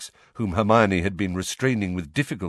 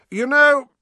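much higher, louder opening; 9 dB louder than usual. A narrator's reading. Sirius speaks loudly to the ceiling to provoke Snape, loud. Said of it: "said Sirius loudly".

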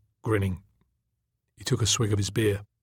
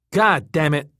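louder, higher irritated outburst; getting pissed off; 5 semitones higher, 6 dB louder than usual. A narrator's reading. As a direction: curse in rising annoyance. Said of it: "He was getting pissed off".

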